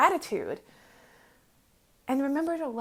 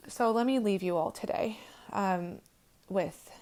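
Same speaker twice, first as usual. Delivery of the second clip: ordinary delivery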